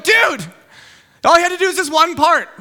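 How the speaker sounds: high, loud protest; exasperated outburst. Acted. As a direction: burst out at a useless teammate. Acted exasperated reaction to slacker.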